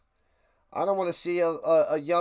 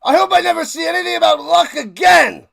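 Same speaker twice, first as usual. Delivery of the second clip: full-throated shout; 9 semitones higher, 10 dB louder than usual. Real, not acted. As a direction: yell it out high and very loud, venting. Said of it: "Well, then say it again".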